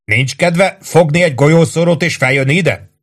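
narrated holler ending in a challenge. A narrator's reading. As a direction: yell down, spacing out the opening words. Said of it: "üvöltötte".

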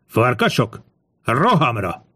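rallying call to charge, held back in volume; a commander's cry at narration volume; battle cry. A narrator's reading. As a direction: call the troops into the attack. Centurion orders his men to charge.

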